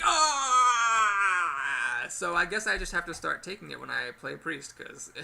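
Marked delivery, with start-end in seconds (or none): 0.0-2.0 pained yell. Retold or imitated. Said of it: "I've been like".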